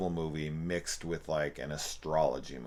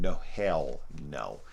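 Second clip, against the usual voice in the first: ordinary talk, nothing marked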